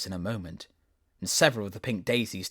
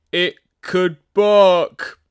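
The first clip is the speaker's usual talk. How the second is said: playful rose, drawn out; 9 semitones higher, 15 dB louder, much slower than usual. Acted. Rose joking.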